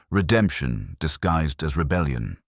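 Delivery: plain reading voice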